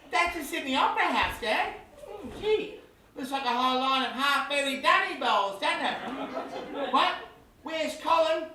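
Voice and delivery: put-on high child voice, wide-eyed; excited little boy. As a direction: chatter to Dad like a sightseeing kid. Plays boy sightseeing with Dad.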